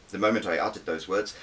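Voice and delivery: ordinary delivery, nothing marked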